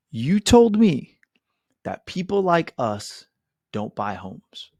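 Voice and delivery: slow, deliberate, starting loud then fading; slow pointed reminder. Retold or imitated. Realtor reminding his client Naomi of her words, slow and pointed. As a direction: lay each word down slowly.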